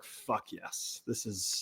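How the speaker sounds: whispered under the breath, very soft; a secret, thrilled whisper to himself; mock whisper. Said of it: "and I was like".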